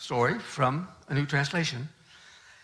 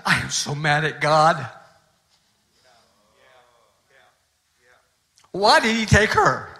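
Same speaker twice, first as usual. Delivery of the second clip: angry; 3 semitones lower, 6 dB louder than usual. Retold or imitated. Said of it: "He was just furious with God".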